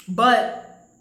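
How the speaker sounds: ordinary delivery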